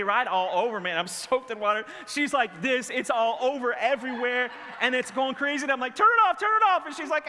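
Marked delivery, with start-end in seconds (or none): 5.9-6.9 high, loud reenacted panic; panicked yelling. Dad to daughter as hose sprays, panicked yell. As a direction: yell urgently over the spraying water.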